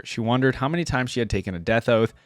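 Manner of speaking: ordinary talk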